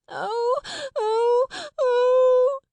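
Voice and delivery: put-on a small child howling oh oh oh; wailing. A narrator's reading. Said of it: "Sarah heard".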